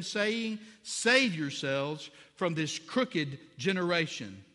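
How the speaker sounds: fervent scripture reading. Acted. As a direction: proclaim the text to the church.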